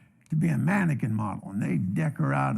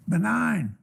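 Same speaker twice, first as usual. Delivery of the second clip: higher-pitched call with a falling end; calling across room; 5 semitones higher than usual. Retold or imitated. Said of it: "standing way across the room there".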